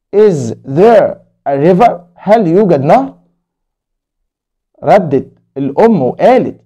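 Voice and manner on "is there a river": Is there a river read very slowly again, rising; slow story reading. Acted. Teacher repeating Hani's question slowly to kids. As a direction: ask it slowly once more for young learners.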